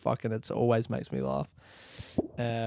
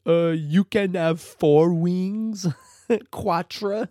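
slow, drawn-out mocking voice, higher and louder; mocking shopkeeper; 6 semitones higher, 7 dB louder, much slower than usual. Shop guy mocking a tourist's order, slow loud mock. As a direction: rinse the customer, spelling it out slowly. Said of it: "he starts making fun of my accent".

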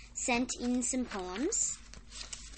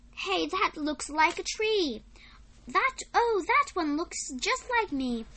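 high, loud, delighted pointing-out; acted excitement, lifted well above the reading voice; excited discovery; 6 semitones higher, 6 dB louder than usual. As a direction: call out like a cloud-watcher spotting shapes. Poem voices cloud-watchers' cries.

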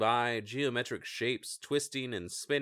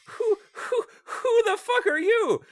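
very high, loud hooting owl; cursing owl; 6 dB louder than usual. Acted. GM's owl demands who touched the button, hooting. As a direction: hoot and swear like an angry owl.

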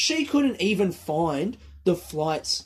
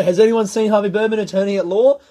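calling out to the crowd, louder and higher; loud onstage question; 4 semitones higher, 8 dB louder than usual. Retold or imitated. Comic asking the silent audience a question, loud and high. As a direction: project it to the back row like a comic asking the room.

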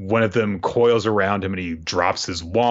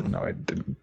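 a whispered, much softer denial; mock whisper; 10 dB quieter than usual. Doubt quietly denying he beat Silva, whispered. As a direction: mutter it under your breath.

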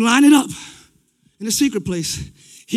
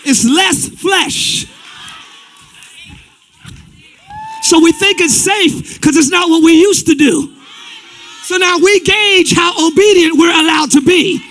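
loud steady pulpit delivery; firm preaching; 5 semitones higher, 6 dB louder than usual. Acted. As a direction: drive the point home to the church. Preaching at full force.

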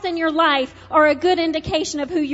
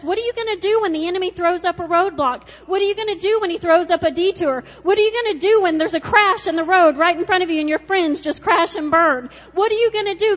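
urgent rising questions, louder, fast; God's challenge; 2 semitones higher, 3 dB louder, faster than usual. Acted. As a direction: press each question harder like a preacher. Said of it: "And God says".

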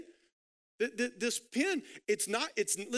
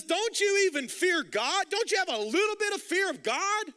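a high, louder scolding; stern rebuke; 8 semitones higher, 4 dB louder than usual. Retold or imitated. Criminal rebuking the other criminal, high scolding. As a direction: shame him for not fearing God. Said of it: "He says".

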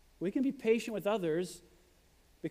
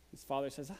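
ordinary talk

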